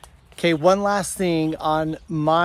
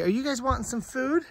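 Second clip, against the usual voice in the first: higher, softer coaxing voice for animals; talking to the goats; 9 semitones higher, 5 dB quieter than usual. Real, not acted. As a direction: coo it to the hungry goats.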